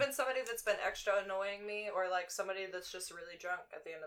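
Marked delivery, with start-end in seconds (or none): none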